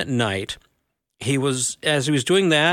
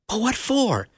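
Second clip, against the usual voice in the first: put-on higher boy's voice; 8 semitones higher than usual. A narrator's reading. Shasta asks why.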